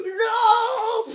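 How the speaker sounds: long, loud, high "non"; sudden hammed-up scream of refusal; mock scream. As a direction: scream it out as a joke. Reassurance flips into screamed no.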